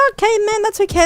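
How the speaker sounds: mock chill shrug of a response; relaxed acceptance. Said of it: "I'd be like".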